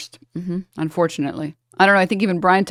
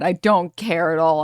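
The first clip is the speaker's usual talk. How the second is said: ordinary delivery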